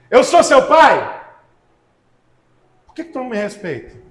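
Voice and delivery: voz de Deus repreendendo, alta e lenta nas perguntas; pregação inflamada. Acted. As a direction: pregue como Deus cobrando o povo. Preacher voicing God's reproach. (pregador dando voz a Deus, em tom forte de repreensão)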